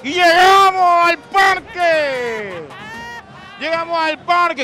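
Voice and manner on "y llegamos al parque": announcer voice. Real, not acted. Announcing arrival at the park.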